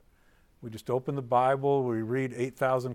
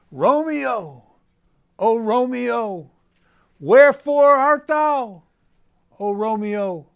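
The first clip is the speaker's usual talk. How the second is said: "Romeo, oh Romeo, wherefore art thou" belted much higher and louder, slow and melodramatic; overwrought declaiming; 10 semitones higher, 13 dB louder, slower than usual. Shown as a demonstration. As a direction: ham it up like a bad stage Juliet searching for Romeo. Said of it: "So maybe do it like this".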